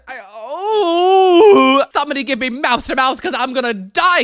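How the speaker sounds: loud, high melodramatic wail; dramatic wail. Real, not acted. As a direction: wail like you're dying. Overwhelmed by gross image.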